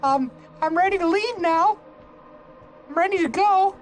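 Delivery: a tiny creature chirping that it's ready; high character voice. Pretend. Gamer voicing a character, high and squeaky.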